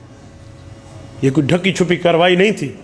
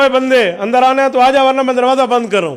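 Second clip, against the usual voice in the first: higher, called out to a man outside; calling gatekeeper; 5 semitones higher than usual. Retold or imitated. Gatekeeper calling to a man lingering outside the fort. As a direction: hurry him in before the gate shuts.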